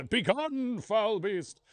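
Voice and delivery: high, loud banishing cry; theatrical command, pitched high and loud; mock exorcism. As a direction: cast out a demon, hammed-up. Pretend exorcism of possessed speech.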